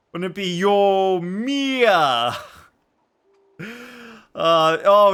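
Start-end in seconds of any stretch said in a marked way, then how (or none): 0.6-2.3 Yomiya drawn out, yo-MEEE-ya, higher; exaggerated stress. Shown as a demonstration. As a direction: stretch Yomiya slowly, swelling on the M. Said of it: "we put the stress on the M".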